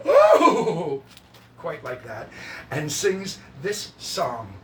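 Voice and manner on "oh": high, loud mock whoop; delighted character whoop. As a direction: let out a hammed-up "oh". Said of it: "and Frank goes".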